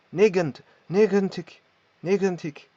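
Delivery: "negentig" with a rasping g from the back of the palate; throaty g. Shown as a demonstration. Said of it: "un son r du fond du palais".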